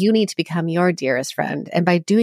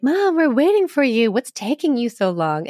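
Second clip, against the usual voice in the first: put-on higher-pitched, playfully teasing kids' voice; mock kids' complaint; 7 semitones higher than usual. Kids teasing mom for being late, mock higher-pitched complaint. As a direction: tease mom like impatient kids in the car. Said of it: "we're like".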